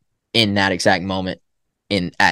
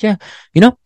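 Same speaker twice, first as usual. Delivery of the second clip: much higher, louder easygoing voice; imitating Brian; 11 semitones higher, 6 dB louder than usual. Retelling what mentor Brian said, higher and breezy. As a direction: lightly voice a laid-back friend sharing tips. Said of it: "And he's like".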